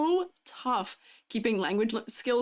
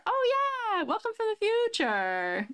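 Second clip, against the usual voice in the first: excited greeting; 12 semitones higher, slower than usual. Real, not acted. Greeting viewer, Australia is ahead.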